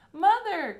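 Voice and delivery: high, loud joyful call; cried out. A narrator's reading. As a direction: call out as he runs to her. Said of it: "cried Charlie".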